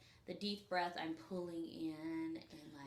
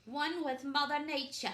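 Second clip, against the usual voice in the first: put-on British accent; 9 semitones higher, 6 dB louder than usual. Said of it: "I got a British accent".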